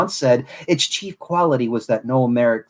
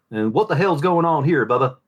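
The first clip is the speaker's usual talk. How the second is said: Southern accent. Shown as a demonstration. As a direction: lean into a Southern Florida accent. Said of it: "between Jean-Luc Picard and Trip Tucker".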